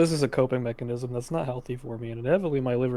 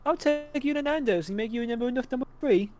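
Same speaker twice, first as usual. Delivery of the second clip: high, fast South London rant; South London accent; 10 semitones higher than usual. Retold or imitated. Mocking a character's accent with a rambling South London threat. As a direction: rattle it off as a South London geezer. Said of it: "wow, that was really good".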